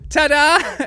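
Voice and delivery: loud, high showman's ta-da; spring it like a jokey magic reveal; joking flourish. Retold or imitated. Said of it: "I tried to make a joke out of it".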